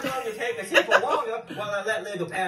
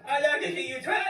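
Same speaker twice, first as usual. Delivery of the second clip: usual speaking voice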